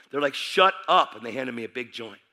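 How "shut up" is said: loud, high mock snap; annoyed rebuff. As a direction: cut him off sharply, imitating bandmates. Bandmates rebuff him again.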